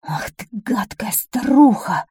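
slow, whispered royal fury; venomous stage whisper; angry hiss. A narrator's reading. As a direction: hiss it through the teeth. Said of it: "зашипел от злости король".

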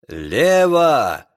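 short barked order; captain's shout. A narrator's reading. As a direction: call the turn sharply. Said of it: "крикнул капитан".

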